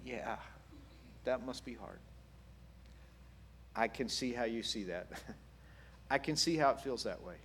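soft, unhurried sympathy; quietly validate their pain; gentle, accepting murmur; understanding listener. Acted. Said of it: "instead of correcting and saying, just go".

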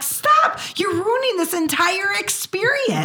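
wife retelling how she shuts down his grumbling; exasperated. Retold or imitated. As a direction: snap at him to quit counting prices. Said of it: "and I'm like".

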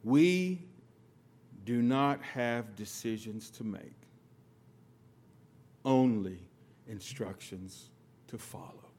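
put-on slow, measured words of an old man; solemn pronouncement. Father-in-law imparting his lesson, slow and grave. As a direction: deliver it slowly like a wise elder's verdict. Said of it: "I'll never forget these words".

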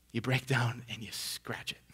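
whisper. Acted. Giving in to the itch.

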